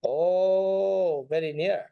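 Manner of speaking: ordinary talk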